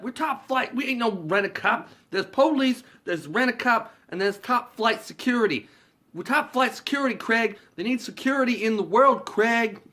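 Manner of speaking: high, loud mock guard; self-important security guard. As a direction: puff up the guard's importance loudly. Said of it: "is taking the job way too seriously".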